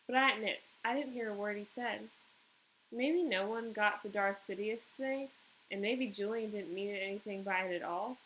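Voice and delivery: usual speaking voice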